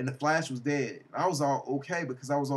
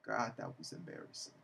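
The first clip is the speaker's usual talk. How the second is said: under the breath, unvoiced; whispered aside; 3 semitones lower, 11 dB quieter than usual. Real, not acted. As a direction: whisper it to yourself. Muttered aside.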